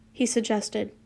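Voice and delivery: plain reading voice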